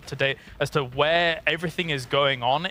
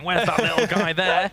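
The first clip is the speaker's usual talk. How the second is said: wildlife documentary voice; 3 semitones higher, 3 dB louder than usual. Retold or imitated. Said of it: "like I'm in a wilderness documentary".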